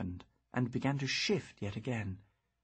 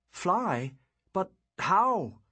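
startled; 6 semitones higher, 6 dB louder, much slower than usual. Acted. Startled by the talking shell.

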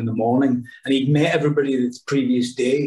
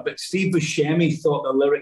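ordinary talk

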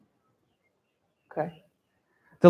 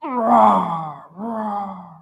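echo; much slower than usual. Shown as a demonstration. Echo coming back up the well, fading roars. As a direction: let each roar die away down the well. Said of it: "There's an echo".

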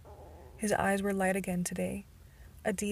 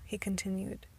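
usual speaking voice

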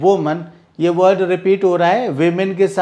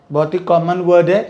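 ordinary delivery

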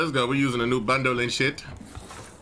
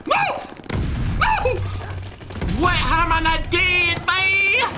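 much higher and louder, slow disbelief; shocked shout; 3 dB louder, slower than usual. Real, not acted. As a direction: yell it like you can't believe you lived. Surviving a near death.

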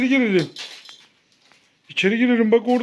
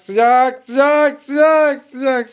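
building excitement; 3 semitones higher, 4 dB louder, much slower than usual. Pretend. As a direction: build the chant as she gets closer. Hot-cold game, child getting closer.

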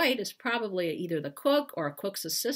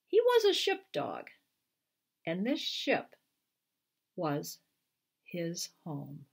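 slow, soothing read-aloud, rising then settling; drowsy bedtime-story close; storybook ending; 4 semitones higher than usual. Acted. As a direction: wind the story down for the kids. Author reads picture book aloud.

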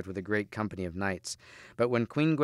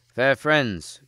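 louder call to passers-by; raised, courteous call; hailing cry; 3 semitones higher, 6 dB louder than usual. A narrator's reading. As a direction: hail them from the roadside. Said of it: "cried Lancelot".

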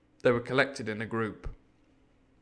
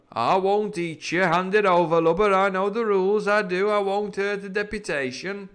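loud, higher pirate voice, mocking and sure; bold pirate captain; 7 semitones higher, 5 dB louder than usual. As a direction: taunt the frightened messenger. Silver voiced by reader.

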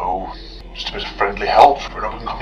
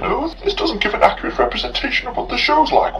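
high, whiny mock complaint; prissy imitation of the producers; 13 semitones higher than usual. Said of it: "are upset that I'm using amateur bootleg".

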